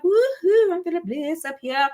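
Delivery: high-pitched woohoo of bliss; acted blissful. Coach showing what high-intensity blissful is like, acted elation. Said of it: "'cause blissful, like".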